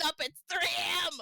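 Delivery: shout. Acted. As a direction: yell the punchline. Punchline yelled.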